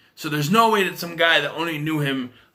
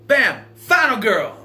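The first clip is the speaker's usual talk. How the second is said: loud, high, drawn-out victory line; mock triumphant shout; 7 semitones higher, 6 dB louder, slower than usual. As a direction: punch it out like a hammed-up action one-liner. Retelling Kim's triumphant killing line.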